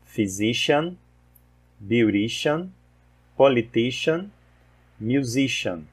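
teacher modelling the -cian ending in "politician"; English -cian endings. Shown as a demonstration. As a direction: model each word, stressing the syllable before "-cian". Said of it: "Então, nós temos aqui".

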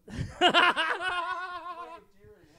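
high, loud laughing fit; loud burst of laughter. Real, not acted. Laughing at joke.

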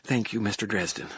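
grateful, breathy thank you; relieved thanks. A narrator's reading.

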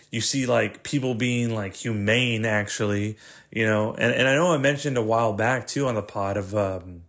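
ordinary talk

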